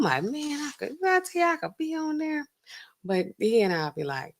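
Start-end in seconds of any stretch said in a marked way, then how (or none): 0.2-2.5 1 excited daydream. Pretend. Imagining herself on the show.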